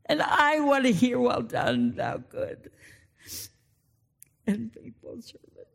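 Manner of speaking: real tears catching the words; choked up. Real, not acted. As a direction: voice breaking into near-silence on well done. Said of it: "I'm sorry, I'm so emotional".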